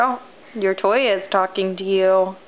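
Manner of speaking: ordinary talk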